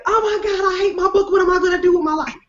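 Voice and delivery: high, wailing author in despair; mock despairing author. Author calling her editor at night, high and despairing. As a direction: wail over a hated book. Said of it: "they'd call me just like".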